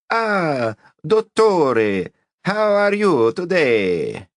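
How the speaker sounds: high, slow, hammed-up Italian accent; corny Italian accent. A narrator's reading. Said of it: "in a corny Italian accent".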